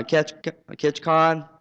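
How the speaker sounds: usual speaking voice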